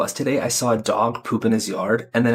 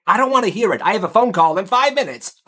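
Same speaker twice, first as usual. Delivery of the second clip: higher, snippy brush-off; dismissive nurse; 7 semitones higher than usual. Acted. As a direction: wave the coach away impatiently.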